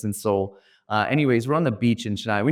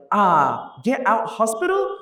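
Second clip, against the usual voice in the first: much higher questioning Indian English; Indian auto driver; 9 semitones higher, slower than usual. Retold or imitated. Indian auto driver to speaker, puzzled accent. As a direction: mishear a curse as a destination. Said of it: "And he's like".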